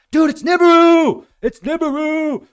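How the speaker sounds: hammed-up panic about the end of the world; freaking out. Mocking a viewer who thinks Nibiru is coming, panicked yell. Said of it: "freaking out".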